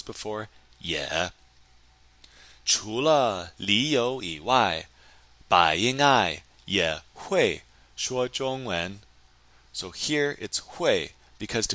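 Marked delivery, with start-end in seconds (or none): 2.6-9.0 slow, careful model reading; slow model sentence. Shown as a demonstration. Teacher reading the answer to students, slow and careful. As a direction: spell each word out for learners. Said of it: "中文怎么说".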